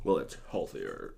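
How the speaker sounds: very low, hushed mock quote; quiet, deep-pitched mocking voice; mocking vapers. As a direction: imitate a vaper under your breath. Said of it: "I love the vapors that say".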